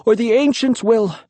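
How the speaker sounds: calling out. A narrator's reading. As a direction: call it after her. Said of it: "I called".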